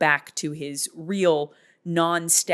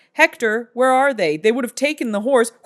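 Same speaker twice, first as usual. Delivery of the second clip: raised, louder comic questioning; mock urgent demand; 5 semitones higher, 6 dB louder than usual. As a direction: play an exasperated king, loudly. Retold king questioning Hector, comic.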